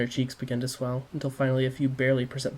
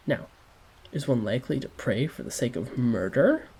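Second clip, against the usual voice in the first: usual speaking voice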